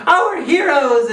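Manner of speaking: slow, loud and grand, like a film trailer; mock dramatic announcer. Reviewer introducing the film's heroes with mock trailer grandeur. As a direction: boom it out like an epic voiceover.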